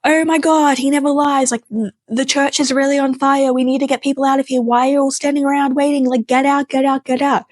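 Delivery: crowd freaking out, urging everyone out; panicked crowd. Retold or imitated. Mourners panicking, telling all to get out, high frantic voices. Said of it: "people lost their flipping mind".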